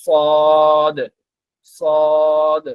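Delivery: সদ said heavy and full; সদ, thick and weighty; thick sad. Shown as a demonstration. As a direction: make সদ fat and strong. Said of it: "সদটা একটু মোটা করে".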